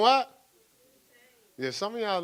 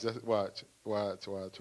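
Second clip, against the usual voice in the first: ordinary talk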